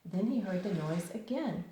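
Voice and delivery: ordinary delivery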